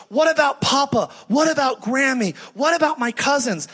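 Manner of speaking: imitated little girl's panic, pitched high; panicking child. Five-year-old asks about family left behind, mock panicking. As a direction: fire off worried questions like an upset kid. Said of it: "And she started panicking".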